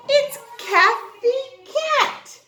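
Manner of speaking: slow, high and loud big reveal; grand reveal. Shown as a demonstration. Teacher introducing Kathy Cat to the class, slow, high and loud. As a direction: unveil it like a surprise present. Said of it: "I have a friend for you to meet".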